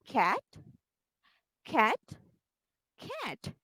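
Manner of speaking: clear, careful cat; modeling a word. Shown as a demonstration. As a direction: say cat slowly and cleanly for the child to copy. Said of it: "I'm going to do it. Listen carefully".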